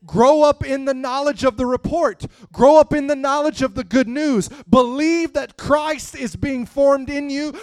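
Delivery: loud, climbing sermon crescendo; preaching at full force. Acted. Preacher pressing the congregation, rising to a loud fervent cry.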